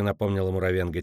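plain reading voice

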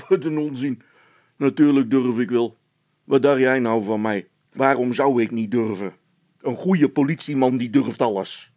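a policeman brushing it off; gruff officer. A narrator's reading. Officer boasting. (stoere veldwachter, wuift het weg)

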